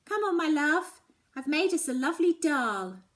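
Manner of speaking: a mother calling her daughter down for dinner; Mum calling. Acted. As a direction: coax her down to dinner with a big voice. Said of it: "Mum's voice interrupts".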